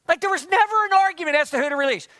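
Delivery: normal talking voice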